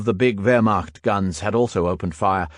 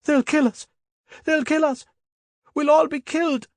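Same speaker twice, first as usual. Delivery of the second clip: shrieking high in terror; panicked crying shout. A narrator's reading. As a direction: break down screaming in panic. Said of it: "He began to cry and shout".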